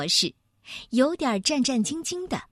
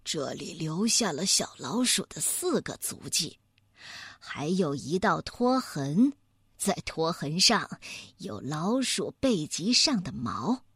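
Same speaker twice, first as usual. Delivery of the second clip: low, softer voice of a scholarly bear; deliberate bear detective; 5 semitones lower, 4 dB quieter than usual. Acted. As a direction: reason it out quietly, clue by clue.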